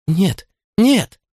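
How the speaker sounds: high, terrified cry; stifled cry. Acted. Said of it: "сдавленный крик".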